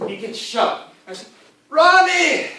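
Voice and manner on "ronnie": high, loud anguished cry of a name; hammed-up desperate shout; calling out. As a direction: scream for a fallen buddy. Calling out to shot friend.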